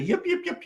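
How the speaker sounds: high yapping yips; coyote yips. Retold or imitated. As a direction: yip like a coyote pup. Imitating coyote yips from website.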